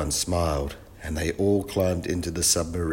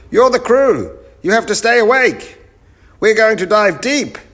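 very high, loud professor's orders; bossy shout; 11 dB louder than usual. Acted. Professor scolds his sleepy crew, high bossy shout. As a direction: shout at the crew, pitch right up.

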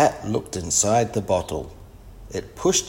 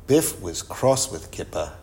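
ordinary delivery

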